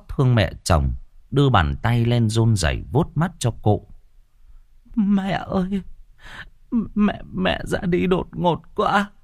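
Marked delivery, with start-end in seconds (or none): 5.0-9.1 crying woman's wail, slowing at the end; weeping lament. A narrator's reading. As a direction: mourn the mother-in-law through tears. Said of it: "bật khóc".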